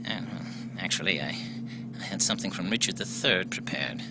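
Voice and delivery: low puppet voice; a low, prim dummy. Acted. Dummy Maxie replies.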